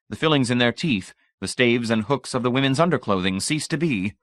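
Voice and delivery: ordinary delivery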